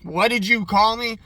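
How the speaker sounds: offended retort. Retold or imitated. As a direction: bristle at the insult. Gene offended by the nickname.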